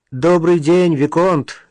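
hearty greeting. A narrator's reading. Old man greets the viscount in the bushes, hailing. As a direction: hail an old friend across the pond. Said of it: "поздоровался он".

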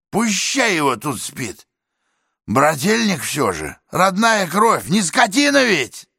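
an excited neighbour justifying himself; chatty tenant voice. Acted. Talkative tenant explains about his brother.

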